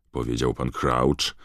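ordinary delivery, nothing marked

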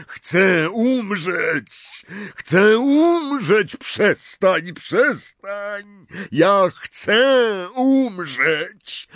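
slow, high, loud cries of terror; terrified scream. A narrator's reading. Dumbledore begging to die in terror, terrified scream. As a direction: shriek it in mounting horror. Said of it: "krzyknął z jeszcze większym przerażeniem".

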